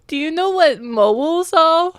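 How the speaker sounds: put-on slow child voice. Child asks slowly.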